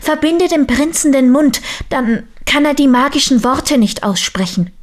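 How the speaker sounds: high-pitched, insistent persuasion; urging sisters. A narrator's reading. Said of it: "Die Schwestern redeten auf Ninfa ein".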